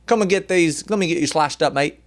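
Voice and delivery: hammed-up Australian butcher. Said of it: "butcher with an Australian accent".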